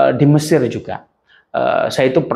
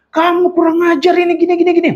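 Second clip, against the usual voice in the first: high, loud scolding rant; loud, high-pitched telling-off; angry scolding; 3 dB louder, faster than usual. Retold or imitated. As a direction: tell him off hotly. Angry quarrel with the Egyptian.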